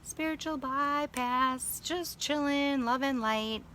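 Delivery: high, slow airy mockery of spiritual calm; mock blissed-out. Mocking the love-and-light crowd, high and slow dreamy voice. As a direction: float it up like a dreamy guru, slow. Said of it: "it's so much nicer to be like".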